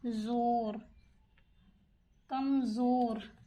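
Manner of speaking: drawn-out, syllable by syllable; slow spelling aloud. Shown as a demonstration. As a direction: say it slowly as you write it. Teacher spells word while writing.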